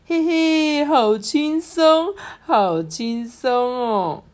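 high, drawn-out gleeful taunt; gloating. Acted. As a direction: gloat slowly in a high little mischief-maker's voice. Lazy mischief-makers gloat over carried bags.

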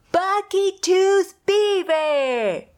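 high, slow schoolyard taunt; mocking taunt. A narrator's reading. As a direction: drawl out the nickname to mock her.

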